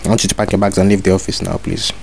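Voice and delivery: normal talking voice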